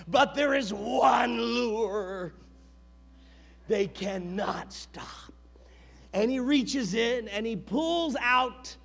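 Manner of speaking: slow, shouted reveal; booming climax. Acted. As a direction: build to a shout. Climax of the sermon.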